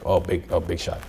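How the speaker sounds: dry, quiet mock of his son; a scoffing father teasing; mocking big shot. Father mocks his son filling a college form, dry and quiet. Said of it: "like, muy criado".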